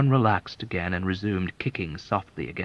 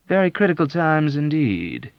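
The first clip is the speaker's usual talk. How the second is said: placid agreement; 8 semitones higher, 5 dB louder than usual. Acted. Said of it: "agreed with a placid nod".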